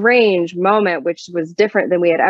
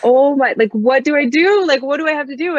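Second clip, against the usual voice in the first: 1 retold panic; 7 semitones higher than usual. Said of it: "I just was like".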